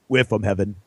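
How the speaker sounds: low solemn reveal; tall goopy angel. Retold or imitated. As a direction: drop it low and flat like a giant. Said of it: "the big, tall, goopy one will look at, eventually just go".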